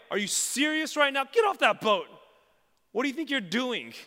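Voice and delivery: high, full-volume exasperated scolding; angry yelling. Acted. Mock angry Jesus orders Peter off the boat, yelling. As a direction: shout orders furiously from far off. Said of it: "yelling at Peter like".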